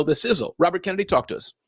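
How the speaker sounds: usual speaking voice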